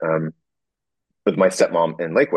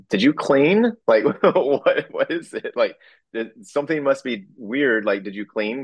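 higher, bemused mom voice, half laughing; puzzled mom; 7 semitones higher than usual. Retold or imitated. Son imitating his puzzled mom, higher and bemused. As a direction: play his mom baffled he called her up.

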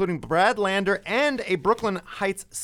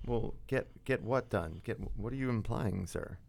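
hushed alarm; 7 semitones lower, 12 dB quieter than usual. Retold or imitated. Reporter alarmed, very soft.

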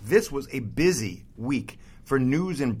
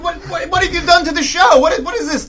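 loud, much higher sputtering outrage; outraged shout; 13 semitones higher, 8 dB louder than usual. Acted. Returning host bursts in on his hijacked show, sputtering angry shout. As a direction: storm in yelling at the hosts.

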